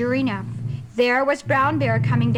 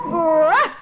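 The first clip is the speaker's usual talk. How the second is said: gruff bear grunt; 2 semitones higher, 6 dB louder than usual. Acted. Bear's gruff sound.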